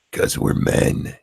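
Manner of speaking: slow, drawn-out manly-man voice; exaggerated manly answer, stretched out; mock manly man. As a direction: put on a slow, unpitched he-man voice. His acted blunt answer for men.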